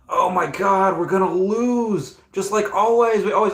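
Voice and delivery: high-pitched complaining fan, drawn-out words; mock moaning football fan; whining sports fan. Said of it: "the whining and complaining".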